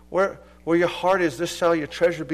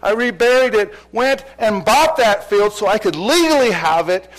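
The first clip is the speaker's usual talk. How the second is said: louder, rising with joy; fervent preaching; 5 semitones higher, 7 dB louder than usual. Real, not acted. Preacher tells the congregation about finding Christ, fervent and loud. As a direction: preach it like you just found treasure. Preacher builds to full force.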